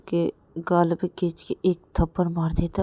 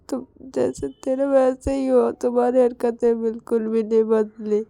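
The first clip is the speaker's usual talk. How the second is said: a hint of tearful whisper turning into a louder complaint; hurt reproach; 6 semitones higher, 6 dB louder than usual. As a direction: accuse him, hurt and on the edge of tears. Hurt wife reproaches husband. (आहत होकर शिकायत)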